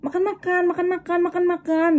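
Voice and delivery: high, repetitive mock nagging; imitated noisy pestering. Coworker pesters her at every meeting, mimicked high nagging. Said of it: "Dia tuh berisik".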